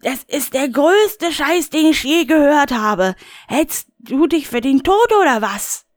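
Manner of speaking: high, indignant Rainer voice; a high, put-out grumble; Rainer, high and agitated. Acted. Rainer brushes off the caller, high and huffy.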